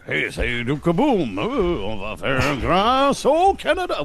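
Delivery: slow, booming stuntman voice in French; Duke Kaboom voice. Retold or imitated. For a fan's kid, a slow Duke Kaboom stuntman voice. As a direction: play the daredevil for the kid's video. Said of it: "So I would be like".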